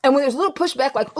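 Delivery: usual speaking voice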